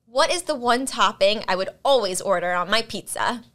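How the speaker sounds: normal talking voice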